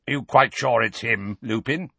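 put-on suspicious growl of an old wizard. A narrator's reading. Moody doubting Harry to Lupin, suspicious growl. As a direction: growl the question out warily. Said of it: "he growled".

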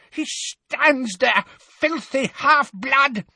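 very high, slow seething; raving. A narrator's reading. Bellatrix raving at Harry, very high slow fury. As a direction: drag out the insult, furious.

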